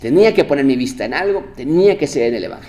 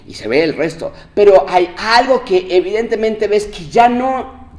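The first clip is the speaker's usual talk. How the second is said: louder, higher preaching build; fervent preacher's push; preaching at full force; 8 semitones higher, 7 dB louder than usual. Acted. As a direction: drive the car illustration home from the pulpit. Preaching on the scratched car.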